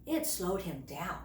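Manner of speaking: plain reading voice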